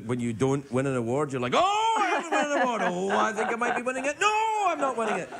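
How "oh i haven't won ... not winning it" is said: high, swooping mock wail; hammed-up nominee panic. Host imitates a nominee losing an award, mock anguish. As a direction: lurch from despair to hope and back, playfully overacted.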